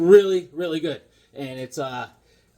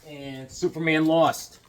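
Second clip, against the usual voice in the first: normal talking voice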